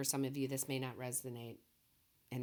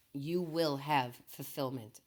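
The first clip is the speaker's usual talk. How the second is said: slow, louder affirmation; louder again; 6 dB louder, slower than usual. Real, not acted. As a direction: lift the voice and slow down. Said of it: "my voice keeps getting lower and quieter".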